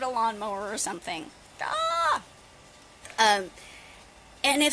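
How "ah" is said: sharp, very high startled "ah"; a real jump-scare yelp. Real, not acted. Vlogger jumps at the lawnmower starting, high yelp.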